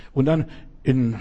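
usual speaking voice

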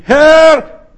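sinking Peter screaming to the Lord; desperate shout. Acted. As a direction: shout for help as you go under.

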